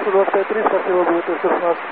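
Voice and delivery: usual speaking voice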